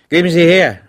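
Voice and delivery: snatch at hope, brightened; newly eager grab for the money; revived, eager. A narrator's reading. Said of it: "sagte der Feldkurat neubelebt".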